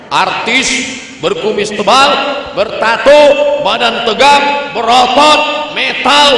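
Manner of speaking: loud, high, rising list; emphatic staccato roll-call; punchy build-up. Real, not acted. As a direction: punch out each item separately. Builds up tough-artist description.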